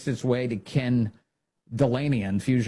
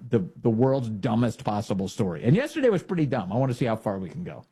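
ordinary delivery

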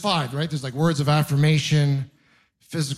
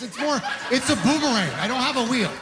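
higher, giggling through the bit; laughing absurd aside; 7 semitones higher than usual. Real, not acted. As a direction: laugh at your own joke.

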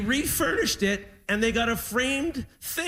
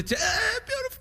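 choked up; 13 semitones higher than usual. Real, not acted. Choking up over dad's arrival.

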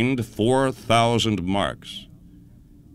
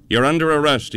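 stern announcement; 6 semitones higher, 4 dB louder than usual. A narrator's reading. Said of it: "he informed that startled official".

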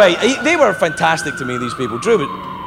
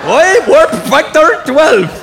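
put-on Scottish accent; 9 semitones higher than usual. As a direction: ham it up like Scotty. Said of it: "Scotty in Star Trek".

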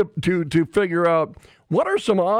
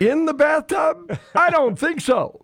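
high-pitched, emphatic disbelief; incredulous; 12 semitones higher than usual. Real, not acted. Host dismissing the bathtub orange law, incredulous. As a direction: scoff with the pitch pushed right up.

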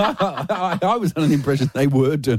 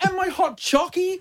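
slow, high-pitched little plea; small, high, needy voice; childlike checking; 13 semitones higher than usual. Pretend. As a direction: ask like a child needing reassurance. Pleading list, echoed by soothing partner.

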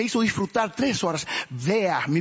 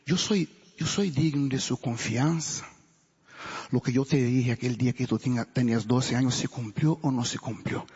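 voice of God; 8 semitones lower than usual. Retold or imitated. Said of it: "Dios me decía".

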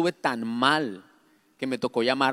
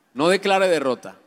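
ordinary talk, nothing marked

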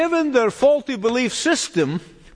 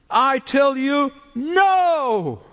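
"I tell you, no!" higher and slow, "no" punched; emphatic "no"; 5 semitones higher, much slower than usual. Shown as a demonstration. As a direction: hammer the "no" home. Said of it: "is in the emphatic position".

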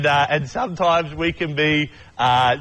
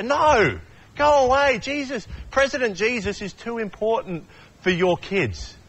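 high dismissive shooing voice, pitched up; disciples shooing kids; 7 semitones higher than usual. Acted. Disciples turning the parents away, high and dismissive. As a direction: brush the parents off.

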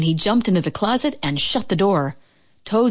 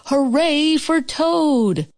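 high, slow, drawn-out cheer; cheering shout; 9 semitones higher, much slower than usual. Acted. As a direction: cheer your friend on. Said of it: "as he shouted".

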